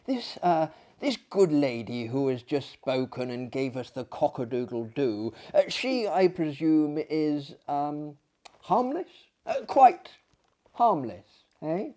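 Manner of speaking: ordinary delivery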